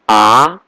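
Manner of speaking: A rising like a question; second tone. Shown as a demonstration. Teacher explaining the second tone, rising A. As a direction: slide A upward to the end. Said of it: "is going up".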